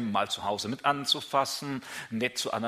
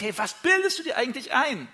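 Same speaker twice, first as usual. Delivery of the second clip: higher, exasperated; mock outrage at arrogance; indignant thought; 11 semitones higher, 3 dB louder than usual. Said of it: "wo man sich denkt".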